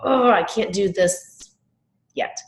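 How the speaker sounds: acted frustration, high groaned 'Oh', then slow; frustrated. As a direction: draw it out in exasperation, pause before 'yet'. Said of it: "the next time she's frustrated".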